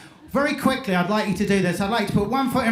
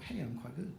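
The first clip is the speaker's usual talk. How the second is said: much softer, private little thought; quietly pleased aside; 3 semitones lower, 15 dB quieter than usual. Retold or imitated. Hypnotist marvelling to himself at his success, quietly pleased. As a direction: murmur it softly to yourself, impressed.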